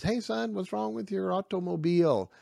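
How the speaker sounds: lightly voiced kindly old man calling over. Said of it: "This old man comes by".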